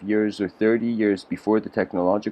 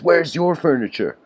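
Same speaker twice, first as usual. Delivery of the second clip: pitched up, pressing and intense; with intensity; 7 semitones higher than usual. Retold or imitated. Dervish confronting his guest with sudden intensity. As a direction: turn the question sharply back on him. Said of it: "and with intensity".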